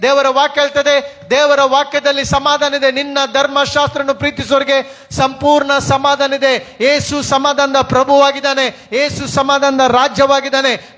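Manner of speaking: driving, declamatory sermon voice held on one high note; impassioned preaching. Acted. Preacher proclaiming Jesus as giver of peace, fervent. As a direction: proclaim it phrase after phrase without letting up.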